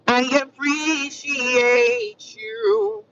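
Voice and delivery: long, lilting thank-you; drawn-out thanks. Real, not acted. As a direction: stretch every syllable way out, playfully. Drawn-out thanks to her viewers.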